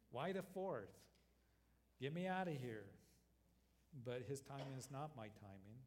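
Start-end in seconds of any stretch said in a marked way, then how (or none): 0.1-2.8 loud, higher-pitched exasperated plea; impatient plea. Acted. Preacher acts out his own prayer to the Lord, impatient plea. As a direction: demand an answer, louder and insistent.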